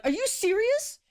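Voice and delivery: high-pitched indignant disbelief; retold "you can't be serious" squeal; incredulous outburst. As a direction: shoot up high, outraged and disbelieving. Retold outburst at being kicked off.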